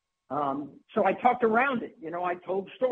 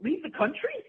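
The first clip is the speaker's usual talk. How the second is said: high, laughing disbelief; a much higher, giggly question to herself; incredulous inner voice; 10 semitones higher, slower than usual. Retold or imitated. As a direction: ask it half-laughing, amazed. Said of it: "I'm thinking to myself".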